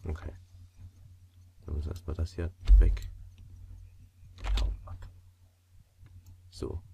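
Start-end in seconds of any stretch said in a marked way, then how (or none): none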